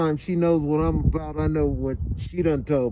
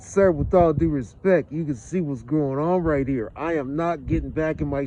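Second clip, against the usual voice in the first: usual speaking voice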